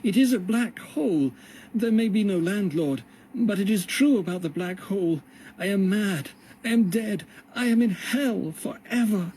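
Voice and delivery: high, strained cry of terror; despairing cry. A narrator's reading. John cries out to himself in despair, high and strained. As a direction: cry out as if the mind has snapped. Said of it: "cried out".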